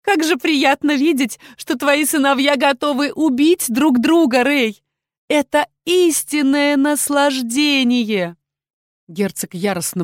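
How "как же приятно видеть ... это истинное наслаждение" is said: high, relishing villainess voice; gloating evil queen. Acted. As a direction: savour it loudly in a raised pitch.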